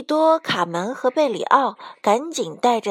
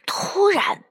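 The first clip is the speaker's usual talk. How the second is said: plain reading voice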